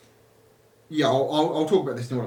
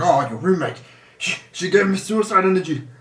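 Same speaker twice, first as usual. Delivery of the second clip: louder, higher mock Scottish outrage; halting, put-on Scottish complaint; attempted Scottish accent; 4 semitones higher, 5 dB louder than usual. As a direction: try a Scottish accent, stumbling into it. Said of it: "I'm trying to do a Scottish accent".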